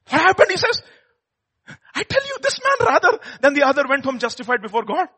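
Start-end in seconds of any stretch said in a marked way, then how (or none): 1.9-5.1 high, ringing proclamation; emphatic proclamation. Acted. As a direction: proclaim it with fervor. Jesus's verdict, high, loud.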